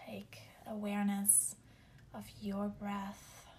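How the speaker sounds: slow, whispered meditation voice; guided meditation. Acted. Leads grounding meditation.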